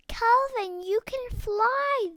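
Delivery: high, loud amazed cry; excited exclaiming. A narrator's reading. Starlings amazed that Calvin can fly, loud excited cry. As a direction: burst out in delighted wonder. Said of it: "they exclaimed".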